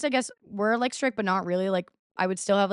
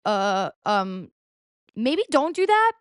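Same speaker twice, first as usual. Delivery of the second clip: hesitant; 3 dB louder, slower than usual. Retold or imitated. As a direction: nervously talk him out of it. Hesitant objection to friend.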